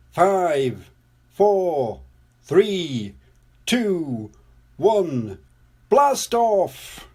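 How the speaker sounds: measured falling count bursting into high blast-off; launch countdown. Acted. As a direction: count down firmly, jump up on the last call.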